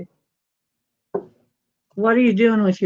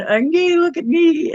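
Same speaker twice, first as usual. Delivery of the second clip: imitating the cat; 7 semitones higher than usual. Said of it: "it sounds like it's saying".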